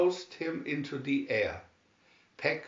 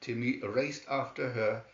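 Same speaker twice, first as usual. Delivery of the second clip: usual speaking voice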